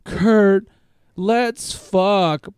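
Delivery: loud, high come-on, drawn out. Retold or imitated. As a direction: put on a crude, high, loud woman's voice. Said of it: "and she's like".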